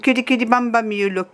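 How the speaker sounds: ordinary talk, nothing marked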